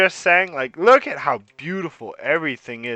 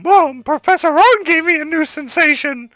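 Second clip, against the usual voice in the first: put-on much higher, louder squeaky kid; 11 semitones higher, 5 dB louder than usual. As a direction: squeak it out high and loud. Player character answers mom.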